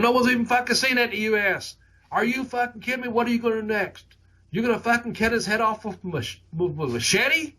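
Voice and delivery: high-pitched, incredulous imitation in a Boston accent; Kevin Sullivan. Veteran grilling two young wrestlers in the hallway, mock incredulous Boston voice. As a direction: imitate an amazed veteran, pitched well above your own voice. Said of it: "it's Kevin in his Boston accent still today".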